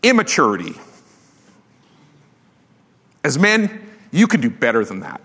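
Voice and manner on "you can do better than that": ordinary talk, nothing marked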